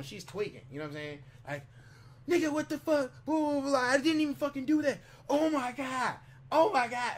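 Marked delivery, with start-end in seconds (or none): 2.3-7.1 high, loud mock outburst; imitated phone yelling. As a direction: mimic her hollering at someone on the phone. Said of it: "yelling at somebody on the phone".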